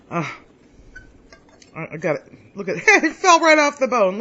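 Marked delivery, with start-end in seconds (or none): 2.5-4.2 laughing with delight. Real, not acted. Meat falls off the bone.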